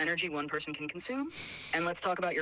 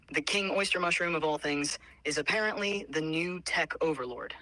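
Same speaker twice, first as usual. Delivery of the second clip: ordinary talk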